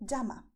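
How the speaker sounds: "llama" said "jama", with English j; ll as in jeans. Shown as a demonstration. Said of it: "the same sound that you have in English when you say jeans".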